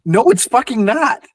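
high, loud indignant retort; exasperated retort. Retold or imitated. Arguing with a friend over Melbourne, loud indignant retort. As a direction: snap it back, fed up. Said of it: "And I'm like".

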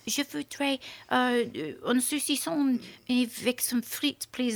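put-on son's halting French with a French accent. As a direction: order haltingly in schoolboy French. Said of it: "Go do it in a French accent".

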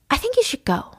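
high, restrained voice asking him out; politely uneasy, pitched well up; uneasy request. Retold or imitated. Acted awkward request to leave.

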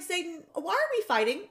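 high, quick confused whine; mock whiny question. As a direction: squeak it out fast, baffled. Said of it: "I was like".

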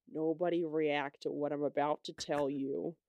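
lazy low character voice, poorly imitated; bad Chong impression. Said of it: "That's my, like, worst Chong impression".

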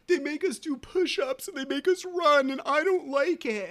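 put-on high, loud whiny little boy; whining child. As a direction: imitate a kid whining in protest. Voicing his son's complaint.

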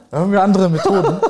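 real laughter in the voice; laughing. Real, not acted. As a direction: joke while cracking up. Laughing joke about methods.

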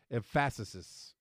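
emphasis as em-FASS-iss-iss; misstressed emphasis, em-FASS-is; wrong emphasis. Pretend. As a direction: stress the second syllable of emphasis, jokingly. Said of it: "the wrong emphasis is on".

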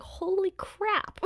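high, drawn-out disbelief; mock shock. Lily hears what Sirius told Snape, mock shocked. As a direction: stretch it out high, as a playful stunned reaction. Acting her shocked reaction.

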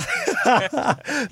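short high burst of laughter; a quick amused laugh. Real, not acted. Host laughs at the accent joke.

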